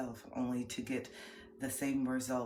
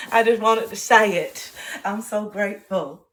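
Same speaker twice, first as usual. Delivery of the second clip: louder, earnest grateful; emphatic gratitude; 4 semitones higher, 12 dB louder than usual. Real, not acted. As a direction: insist on how grateful you are.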